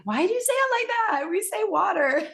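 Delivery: high, fast mock outrage at a British pronunciation; mother teasing her kids over British pronunciation; mock indignant. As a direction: play the offended American mom, laughing. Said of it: "I'm like".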